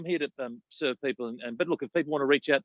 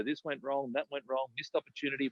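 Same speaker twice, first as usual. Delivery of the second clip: tale of woe; 8 dB quieter than usual. Retold or imitated. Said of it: "all the tale of woe".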